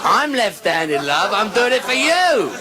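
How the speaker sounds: loud, rising in pitch; called-out banter. Real, not acted. Comedian ribbing an audience member, loud high call. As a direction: holler it cheekily at the audience.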